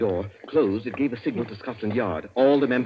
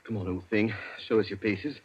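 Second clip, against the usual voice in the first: soft coaxing; 3 semitones lower, 7 dB quieter than usual. Acted. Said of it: "I hope you haven't hurt my voice".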